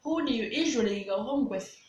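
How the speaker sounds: ordinary talk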